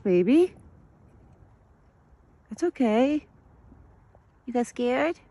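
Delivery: high, sweet voice calming a scared deer; soothing pet talk. Acted. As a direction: coo to the frightened deer.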